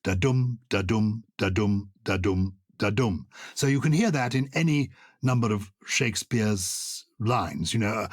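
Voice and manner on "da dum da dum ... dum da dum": five even beats of "da-dum", each stressed on "dum"; iambic pentameter rhythm. Shown as a demonstration. Host demonstrating a standard line, "da-dum" stressed on "dum". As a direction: beat out "da-dum" five times, pausing between, "dum" stronger. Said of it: "five iambic feet in a row".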